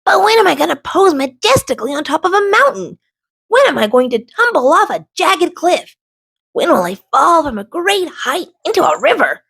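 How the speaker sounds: high, fast, eager little rock; a rock itching for adventure; high eager voice, rushing the questions; eager young rock. A narrator's reading. Rick voiced by narrator.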